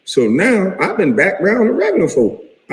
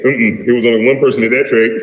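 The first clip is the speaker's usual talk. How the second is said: mocking Jack's voice; 4 semitones lower than usual.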